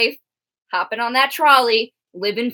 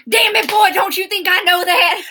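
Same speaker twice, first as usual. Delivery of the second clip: put-on country accent, higher and louder, angry; angry country man; 7 semitones higher, 4 dB louder than usual. S0 voicing FP as an angry country man in a recap. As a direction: bark it like an angry country dad. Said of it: "She always gives F P a, a country accent".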